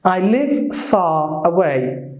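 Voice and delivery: slow demo, FAR as "fah" with no R; British FAR with a long open ending; British silent R. Shown as a demonstration. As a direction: drop the R from FAR, British style. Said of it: "Unlike the British accent".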